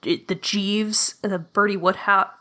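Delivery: ordinary delivery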